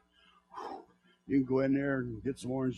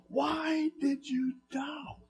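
1 slow questioning; 10 semitones higher, 5 dB quieter, much slower than usual. Pretend. God questioning the believer.

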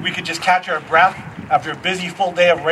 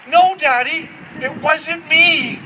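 put-on toddler voice; 6 semitones higher, slower than usual. Toddler denying.

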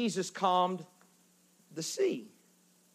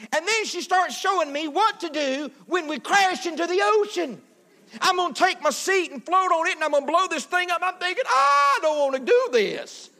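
high-pitched, hammed-up alarm; mock panicked passenger; 10 semitones higher, 4 dB louder than usual. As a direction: wail comically about wanting off the plane. Acts out panicked airplane passenger.

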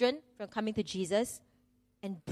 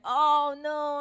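drawn-out, higher-pitched cry of dismay; mock dismay; 5 semitones higher than usual. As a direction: replay a parent's embarrassed horror, hammed-up. Retold first thought at child's accident.

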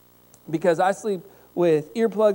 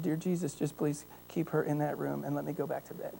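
much softer, pleading prayer; quiet prayer; 2 semitones lower, 10 dB quieter than usual. Retold or imitated. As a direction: mutter a tired prayer leaving her room. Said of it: "and I said".

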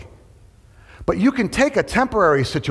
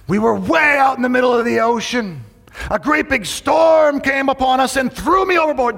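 pirate voice; 10 semitones higher than usual. Acted. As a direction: belt it like a sea captain. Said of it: "He said".

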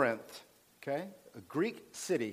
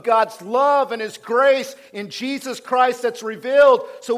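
loud, higher-pitched pulpit proclamation; fired-up preaching; 6 semitones higher, 9 dB louder than usual. Real, not acted. Preacher telling the church what it is about, full-force preaching. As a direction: preach it at full force, driving each phrase.

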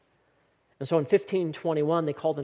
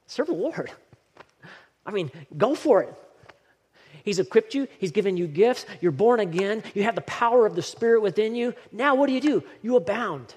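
higher, louder preaching push; rally the congregation to act; rousing sermon climax; fiery preaching; 4 semitones higher, 4 dB louder than usual. Acted. Preaching at full force.

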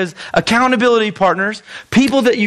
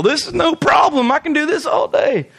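higher, mock overconfident boast; lightly hammed-up bragging; cocky boast; 9 semitones higher than usual. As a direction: play the smug guy sure it's easy. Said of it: "some of you are gonna go".

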